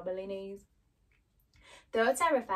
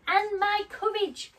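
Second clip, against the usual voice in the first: high, loud, slow question; pleading Lion; 11 semitones higher, 7 dB louder, slower than usual. Acted. Lion asks wizard about courage, pleading. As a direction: stretch it out high and insistent.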